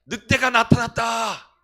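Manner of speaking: loud, slow shout of the alarm; shepherd boy's shout. Retold or imitated. Preacher acting the shepherd boy crying wolf, a loud slow shout. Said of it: "이 이야기는 아나요?".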